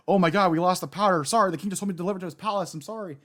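imitating a panicked worker, high-pitched and hurried; flustered apology.

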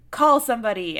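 urgent call. Retold or imitated. Guards ordering help during the chaotic execution, loud and urgent. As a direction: bark it out in the chaos. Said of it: "they were just like".

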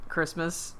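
normal talking voice

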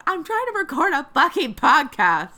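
high, loud mock yell through a laugh. Yelling at fireworks neighbors, laughing mock yell. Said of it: "Go outside and yell".

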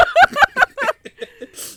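shrieking laugh; high loud laugh. Real, not acted. After the lungs joke, high loud laugh.

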